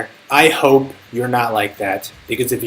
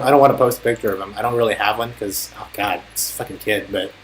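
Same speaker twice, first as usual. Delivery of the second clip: normal talking voice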